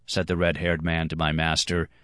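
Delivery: ordinary delivery, nothing marked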